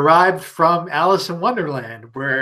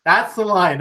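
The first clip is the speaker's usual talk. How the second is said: mock exasperated shout; 6 semitones higher, 3 dB louder than usual. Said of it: "And then I'm like".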